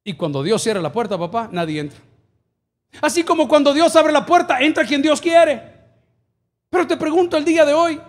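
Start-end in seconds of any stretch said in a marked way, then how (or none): 3.0-8.0 high, ringing preacher's proclamation; forceful preaching. Real, not acted. Pastor declaring God opens doors, forceful preaching. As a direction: proclaim it to the congregation.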